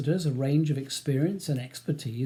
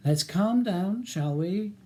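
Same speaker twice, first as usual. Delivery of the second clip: higher, placating lilt; calm them down in a higher voice; woman's soothing voice; 5 semitones higher, slower than usual. A narrator's reading. Woman barrister calming Ronnie and Charles, soothing. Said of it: "says a new voice".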